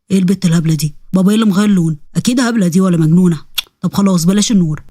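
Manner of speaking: low-pitched, grumbling ifrit voice; scheming ifrit. Acted. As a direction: drop the pitch and plot to scare the girl.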